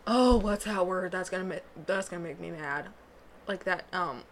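ordinary talk

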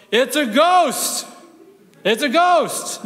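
slow, high, loud cry of fear; terrified shout. Acted. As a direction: shout it as if seeing a ghost in the dark. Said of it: "with a burst of fear and, and trembling in his voice, shouted".

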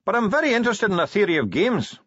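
cheerful high dwarf voice. Acted. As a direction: greet the commander cheerfully, pitched well up. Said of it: "said the dwarf cheerfully".

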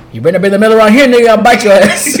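loud, high threatening dog act; mock threat. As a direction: play a guard dog warning off an intruder.

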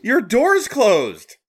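high and slow, baffled; drawn-out disbelief; incredulous. Real, not acted. Cat got through closed door.

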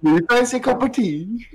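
high British attempt sliding into a leprechaun mascot accent; British accent turned cartoon Irish. Friend attempts the British sentence in a contest, cartoonish high accent. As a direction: botch a British accent into a high Lucky Charms Irish lilt. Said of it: "Why'd you turn into the Lucky Charms mascot".

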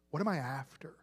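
breathy, unvoiced self-question; a private whisper of searching. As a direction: ask it to yourself under your breath.

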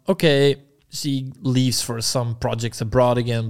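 ordinary talk, nothing marked

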